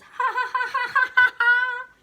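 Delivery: loud, high staccato "ha ha" laugh; high, loud mock laughter; acted character laugh. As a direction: belt out a high, pretend storybook laugh. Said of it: "And Bert says".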